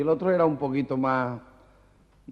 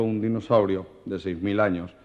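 normal talking voice

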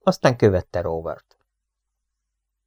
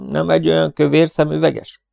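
lively, smiling; 6 semitones higher than usual. A narrator's reading. Robin asks the rancher about the missing engineer, lively and smiling. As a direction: lively question with a smile, lifted higher. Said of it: "kérdezte élénken és mosolyogva Robin".

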